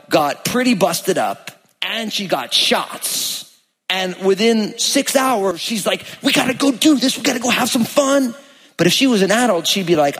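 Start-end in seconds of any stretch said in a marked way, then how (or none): 6.2-8.3 put-on high, bouncy little-kid voice; eager little girl. Dad voices his daughter begging to go play, high and eager. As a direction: chirp it like an excited small child.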